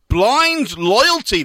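slow, loud title announcement; announcer voice. Acted. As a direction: present the title like a show host.

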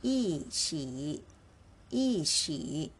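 一起 read yìqǐ, 一 falling fourth tone; 一起 读作 yìqǐ，一 读第四声. Shown as a demonstration. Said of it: "it should be read as E".

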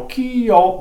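"key" held high, then dropping on "out"; air dropped off. Shown as a demonstration. Caller explaining the yelp, high "key" dropping into "out". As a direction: hold "key" up and let "out" fall away. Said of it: "I'm just dropping the air off and dropping my tongue".

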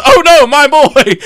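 high, loud, drawn-out wail; hammed-up cry of alarm; mock dismay. Hero sees Tengen fly out, loud mock dismay. Acting hero seeing friend thrown.

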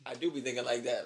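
ordinary talk

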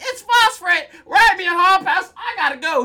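put-on high, loud panicked kid blurting it out; frantic shout. Frantic kid voiced in recap.

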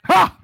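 sudden high, loud "Ha!"; short piercing whoop, mimicking a colleague; imitated ecstatic cry. Colleague breaking into a dance, mimicked high loud cry.

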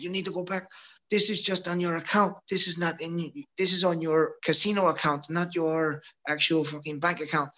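lightly voiced Russian coach, pitched high; a hint of a Russian coach. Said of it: "Czar, speaks Russian".

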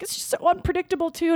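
high, hurried tearful imitation; imitated bawling. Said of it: "she just started full on bawling".

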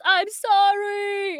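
high, drawn-out yell to the sky; anguished shout. Acted. He shouts at the sky where no one hears, anguished shout. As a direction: shout it slowly, stretching the last word. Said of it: "And he shouts".

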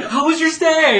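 high, loud chirpy question; mock bright greeting; cheery host. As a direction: ask sweetly like a hotel host. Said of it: "She's still like".

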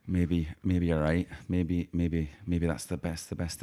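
put-on low, soft, creeping Irish accent; accent slipping into Irish. Gang member giving in to the Irishman, slipping into Irish. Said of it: "What is going on with my accent".

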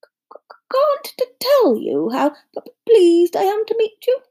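high-pitched, stuttering professor; timid, halting greeting; nervous stammer. A narrator's reading. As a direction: stumble over the first sounds of words. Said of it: "stammered Professor Quirrell".

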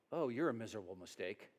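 low, quiet mock-dismissive aside; muttered disappointment. God supposedly judging what he made, muttered disappointment.

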